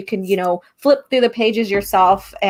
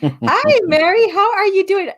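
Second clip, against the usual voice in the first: excited greeting; 11 semitones higher than usual. Real, not acted. Greeting a viewer on stream.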